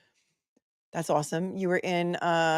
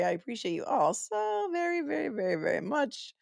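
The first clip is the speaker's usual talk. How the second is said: gushing thanks; 9 semitones higher than usual. Real, not acted. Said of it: "gushing thanks to chat".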